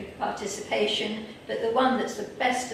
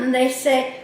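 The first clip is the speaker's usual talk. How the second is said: ordinary talk, nothing marked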